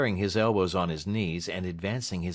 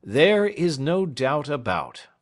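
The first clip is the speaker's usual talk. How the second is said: louder, slow, higher retort; Snitchey retorting; 7 semitones higher, 7 dB louder, slower than usual. Acted. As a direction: pin the point down firmly. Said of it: "retorted Mister Snitchey".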